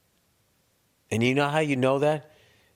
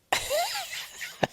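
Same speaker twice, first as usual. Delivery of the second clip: a short high laugh at himself; 6 dB quieter than usual. Real, not acted. Laughing at having gone to zero games. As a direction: bursts out laughing.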